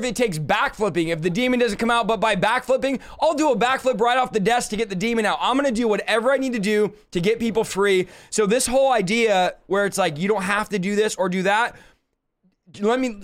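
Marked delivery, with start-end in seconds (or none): none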